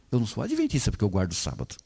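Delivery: fast, quiet correction. Acted. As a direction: rush the denial quietly. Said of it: "Eu sou um adventista porque eu guardo o sábado". (correção rápida e baixa)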